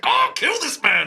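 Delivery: high, loud, slow strangled cry of rage; outraged high wail; furious viewer. Retold or imitated. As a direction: scream it at the screen, drawn out. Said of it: "And you're like".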